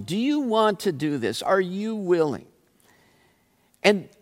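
normal talking voice

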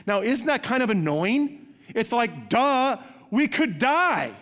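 duh, playing the panicked disciple; exasperated. Pastor mocking disciples' panic to congregation, exasperated.

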